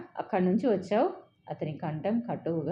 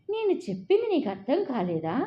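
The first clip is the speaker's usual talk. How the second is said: a touch of annoyance and anger, a bit higher; irritated and angry; 7 semitones higher, 3 dB louder than usual. A narrator's reading. Girlfriend to boyfriend, mildly irritated and angry. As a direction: ask with mild irritated anger. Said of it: "విసుగు, కోపం కలిపి అడిగింది".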